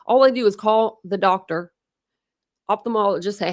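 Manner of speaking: ordinary talk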